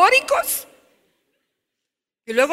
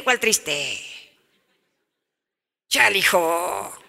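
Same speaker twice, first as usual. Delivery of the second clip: much lower, curt brush-off; dismissive teen; 6 semitones lower, 3 dB quieter, much slower than usual. Acted. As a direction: deny it with a shrug in the voice. Teen denies sadness.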